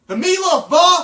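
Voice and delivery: yelling. Pretend. Joins the mock yell at mom.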